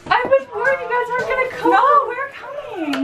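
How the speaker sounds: high-pitched mock voice. Same mock high voice continues.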